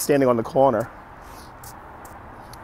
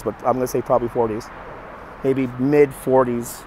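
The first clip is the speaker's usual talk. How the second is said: ordinary talk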